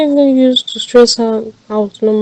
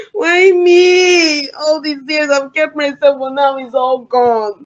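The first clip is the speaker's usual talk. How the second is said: weeping. Acted. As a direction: let the grief break through the words. Victim weeps over lost virtue.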